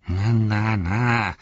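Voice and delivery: lightly growled orc objection; growling. A narrator's reading. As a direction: growl a warning to back off. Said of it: "knurrte Shagrat".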